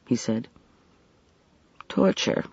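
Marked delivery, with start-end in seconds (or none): 1.9-2.4 soft murmur. A narrator's reading. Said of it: "she murmured".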